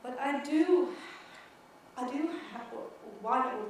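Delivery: usual speaking voice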